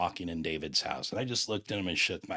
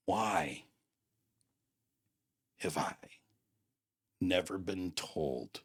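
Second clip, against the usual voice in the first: retold tearful disbelief; 4 dB quieter, slower than usual. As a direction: imitate someone spent from crying, long pauses between words. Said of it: "He fell on his face bawling".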